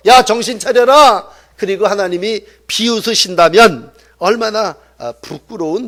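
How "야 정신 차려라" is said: raised-voice reprimand; loud mock telling-off; acted scolding. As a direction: call them sharply to their senses. God scolding the foolish.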